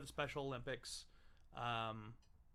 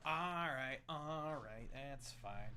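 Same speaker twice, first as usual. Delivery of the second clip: ordinary delivery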